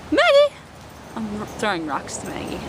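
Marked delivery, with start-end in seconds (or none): 0.1-0.5 loud high call; shouting for the dog. Real, not acted. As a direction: yell her name to bring her over. Calling the dog loudly.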